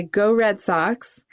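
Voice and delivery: ordinary talk, nothing marked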